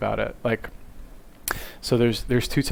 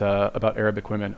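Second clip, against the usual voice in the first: normal talking voice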